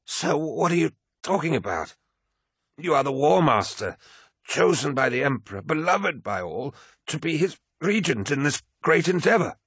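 high, fervent outburst from a devoted aide; shocked protest. A narrator's reading. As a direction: plead his greatness to him, pitched right up. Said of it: "Malakhurst was shocked".

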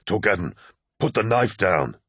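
restrained, earnest plea; mildly pleading, measured. A narrator's reading. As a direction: urge a friend to back down. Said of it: "the pleading tone".

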